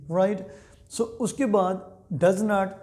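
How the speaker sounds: ordinary talk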